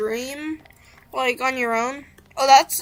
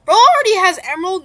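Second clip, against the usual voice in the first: high, loud, fast disbelief; can't believe what you see; shocked; 6 semitones higher, 8 dB louder, faster than usual. Real, not acted. Shocked at opponent's gear.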